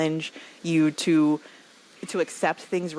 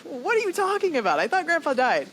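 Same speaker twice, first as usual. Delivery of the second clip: much higher, stunned and baffled; stunned disbelief; 12 semitones higher than usual. Retold or imitated. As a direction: blurt it out in shocked confusion. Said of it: "And we're all just like".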